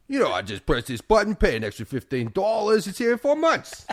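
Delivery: high, loud-ish mock New York boasting; cartoon New York shopper explaining himself; New Yorker accent. Acted New Yorker shopping online.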